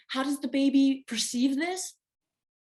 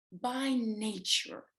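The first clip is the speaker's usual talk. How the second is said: by nature slow and heavy, each word leaned on; stressed; slower than usual. Real, not acted. Speaker to listeners, stressing by nature slowly. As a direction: drag out by nature with weight. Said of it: "stressing it like that".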